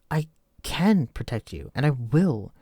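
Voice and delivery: loud stress on "can" and "will"; insist, leaning on "can" and "will"; emphatic promise. Acted. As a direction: hit "can" and "will" high and hard. Insisting they will protect sergeant.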